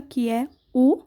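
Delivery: ordinary talk, nothing marked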